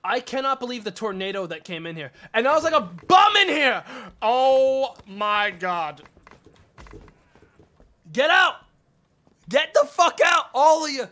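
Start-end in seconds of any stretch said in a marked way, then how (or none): 2.3-11.1 loud, high furious yelling; furious shout. Real, not acted. Streamer ordering all customers out, furious shout. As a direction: throw every one of them out at full volume. Kicking everyone out of the store.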